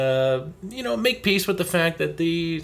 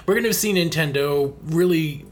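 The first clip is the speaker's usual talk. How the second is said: ordinary talk, nothing marked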